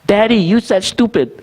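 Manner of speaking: imitating a small daughter, high-pitched and slow; young daughter. Daughter correcting her dad on a bad word, imitated little-girl voice. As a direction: play a little kid pointing out a slip. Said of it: "나중에는 뭐라 그러냐면은".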